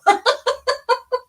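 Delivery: high, strained laugh after biting tongue; pained laugh. Real, not acted. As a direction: laugh through the sting.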